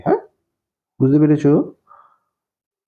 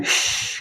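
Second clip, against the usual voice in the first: long, voiceless snake hiss; 6 dB quieter, much slower than usual. Shown as a demonstration. Teacher shows child how a snake calls, drawn-out hiss. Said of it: "সাপ কি হয় ডাকে".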